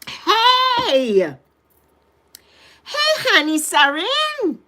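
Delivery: high, loud excited hello; light up at seeing a friend; high delighted welcome to a viewer; excited greeting. Real, not acted.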